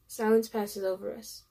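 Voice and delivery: plain reading voice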